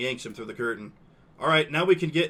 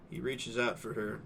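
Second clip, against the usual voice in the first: usual speaking voice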